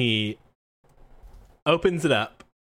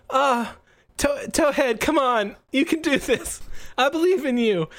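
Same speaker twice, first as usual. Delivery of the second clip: put-on high, pleading kid trainer voice; anxious encouragement; 11 semitones higher than usual. Young trainer urging on his tangled Pokemon, anxiously encouraging. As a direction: urge your Pokemon on in a high, worried voice. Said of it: "and he's like".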